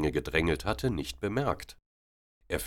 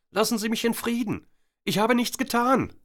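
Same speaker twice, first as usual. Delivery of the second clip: much higher, loud frightened cry; 5 dB louder than usual. A narrator's reading. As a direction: call out for help against him. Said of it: "rief Christina". (viel höher, laut und verängstigt gerufen)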